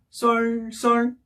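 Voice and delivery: "썰, 썰" held on the same level pitch; double first tone. Shown as a demonstration. Teacher repeating the rule, "썰, 썰" level tones. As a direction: keep both "썰" unwavering. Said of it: "이 톤이요".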